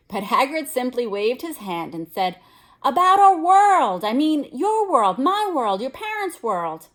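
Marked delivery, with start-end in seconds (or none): 2.8-6.9 slow, much louder and higher burst; outburst. A narrator's reading. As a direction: hammer each world word loudly. Hagrid exploding with anger.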